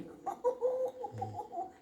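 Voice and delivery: high wheezy cackling laugh; high cackle. Acted. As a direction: cackle at your own trick. Merlin laughs at his trick.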